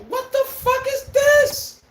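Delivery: high, drawn-out outraged disbelief; incredulous outburst. Retold or imitated. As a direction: throw your voice way up, stunned at the refs. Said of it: "I'm like".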